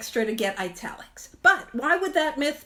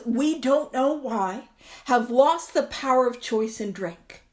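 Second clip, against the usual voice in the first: normal talking voice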